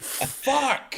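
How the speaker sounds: high, strained gasp of a word; laughing disbelief; high-pitched overwhelmed curse; floored. Real, not acted. Overwhelmed by the joke.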